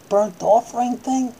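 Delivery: ordinary talk, nothing marked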